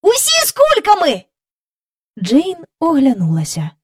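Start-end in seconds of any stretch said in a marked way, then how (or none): 0.0-1.2 put-on high, loud boyish call; excited shout. A narrator's reading. Michael shouting to Jane to look. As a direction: shout to get her to look.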